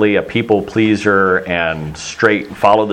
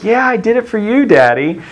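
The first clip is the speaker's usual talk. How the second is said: put-on high, syrupy teen voice buttering up dad; teen sweet-talking daddy, much higher voice; sweet-talking teenager; 12 semitones higher than usual. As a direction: coo it up high like a kid angling for a favour.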